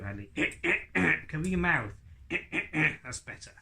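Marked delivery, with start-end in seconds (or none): 0.4-1.2 deliberate puppet throat-clear. Acted. Puppet obeys the ventriloquist, exaggerated throat clearing. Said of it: "Clear your throat". 2.0-3.0 deliberate puppet throat-clear. Acted. Puppet obeys the ventriloquist, exaggerated throat clearing. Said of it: "Clear your throat".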